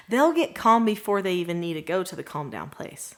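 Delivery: ordinary delivery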